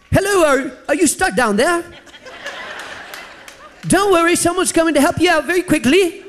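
put-on a light Irish accent, pitched high. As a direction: voice the Irish helper, a hint of the brogue. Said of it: "That sounds more like an Irish accent".

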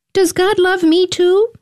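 put-on high, small child's voice. A narrator's reading. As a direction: ask it like a little boy. Narrator voices young Samuel.

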